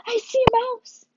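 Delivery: high-pitched startled squeal. Shown as a demonstration. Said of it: "She's squealing".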